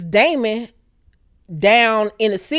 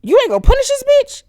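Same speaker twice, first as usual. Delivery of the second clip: high, louder indignant whine; outraged princess; 4 dB louder, faster than usual. Retold or imitated. Rhaenyra to her father the king, high outraged whine. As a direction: demand it, pitched way up. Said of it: "Rhaenyra looking at her dad like".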